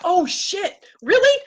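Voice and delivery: floored by the coincidence; reliving the shock. Retold or imitated. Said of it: "I was like".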